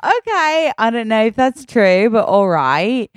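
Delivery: put-on sudden high British accent, slowing at the end. Daughter masks upset at bunk bed in British accent. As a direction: slip into British when upset. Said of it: "You were talking in a British accent".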